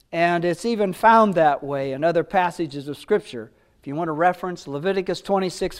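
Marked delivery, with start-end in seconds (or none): none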